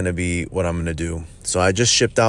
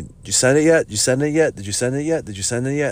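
customers nagging; 5 semitones higher, faster than usual. Retold or imitated. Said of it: "my customers yell at me".

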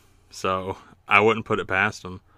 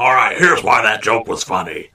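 loud announcing impression of the boss; Vince; 5 dB louder than usual. Boss explaining a failed joke to everybody, loud mock announcement. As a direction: announce it loudly, hammed-up. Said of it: "Vince comes out, he's like".

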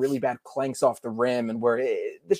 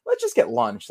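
mock lazy team voice. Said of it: "the team just saying".

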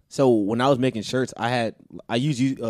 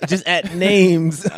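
mock disbelief; 6 semitones higher, 6 dB louder, much slower than usual. Mocking worker's task.

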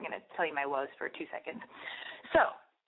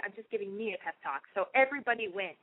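ordinary delivery

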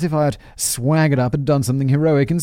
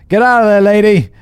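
higher, louder barked order, no accent; wrong first take; 10 semitones higher, 10 dB louder than usual. Shown as a demonstration. First try at Alex's line to the ghost, loud and high. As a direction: yell it at the ghost in your own voice. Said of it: "Wait, sorry".

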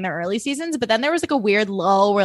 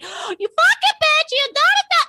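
Teresa. Retold or imitated. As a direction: imitate an outraged rant in a really high voice. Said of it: "her voice gets really high".

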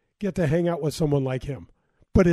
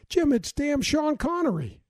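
surprised; 9 semitones higher than usual. Retold or imitated. Retelling his shock at meeting Connery.

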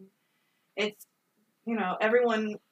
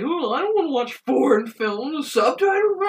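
higher, whiny mock voice; mocking people who dislike subtitles; mocking whiner; 4 semitones higher, 4 dB louder than usual. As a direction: sneer at foreign-film haters. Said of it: "another human being say".

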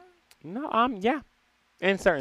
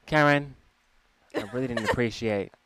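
politely impatient; 9 semitones lower, 5 dB quieter than usual. Pretend. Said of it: "send an email".

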